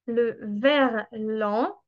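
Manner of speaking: le verlan said very slowly, stretched out; slow, clear verlan. Shown as a demonstration. Teacher to foreign students, slowly introducing the term verlan. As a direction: spell out le verlan slowly for learners. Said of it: "ça vient du terme à l'envers".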